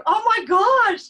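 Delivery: high, loud, slow amazement; overjoyed gasp. Retold or imitated. Meeting her dog again, high, loud and slow. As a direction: gush with sudden joy.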